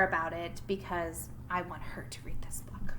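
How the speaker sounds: hushed whisper to the class. Real, not acted. Teacher whispering a secret aside to her class. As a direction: lean in and whisper.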